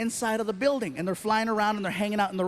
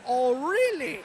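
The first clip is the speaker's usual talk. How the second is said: mock German; 3 semitones higher than usual.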